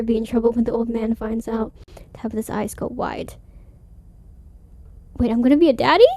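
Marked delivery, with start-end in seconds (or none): none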